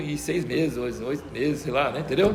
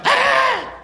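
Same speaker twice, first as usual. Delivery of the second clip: put-on child's shriek; 4 dB louder than usual. Granddaughter refused, screams.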